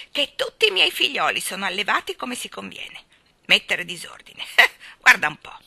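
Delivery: loud, offended huffing; icy retort. A narrator's reading. Starling defends his children's manners, haughty indignation. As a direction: huff indignantly with a scornful laugh. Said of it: "ribatté in tono glaciale".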